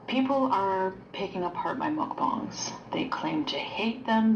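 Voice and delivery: whispered, ASMR-style. Real, not acted. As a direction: breathe it out unvoiced. Clip speaker whispers.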